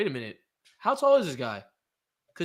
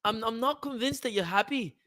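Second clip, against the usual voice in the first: put-on higher-pitched Scouse accent; Patty the Batty; 5 semitones higher than usual. Podcaster playing fighter defending his diet, put-on Scouse accent. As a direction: imitate a Liverpool fighter, lilting Scouse. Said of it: "the Scouser. It's the Scouser".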